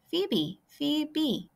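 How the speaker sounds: high, light chirped "Phoebe, Phoebe"; phoebe birdcall. A narrator's reading. Narrator voices the phoebe as a high chirped call. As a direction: sing it like a bird on a bough.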